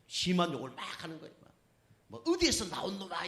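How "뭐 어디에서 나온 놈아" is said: higher-pitched mock swearing; retold cursing. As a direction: imitate a driver cursing in a street quarrel. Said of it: "이러면서 막 욕을 하는 거예요". (싸우며 욕하는 흉내)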